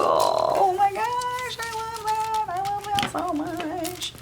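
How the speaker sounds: high, slow, squealing gush of delight. Real, not acted. Crafter squealing at her foil result, delighted. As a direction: squeal over how pretty it turned out.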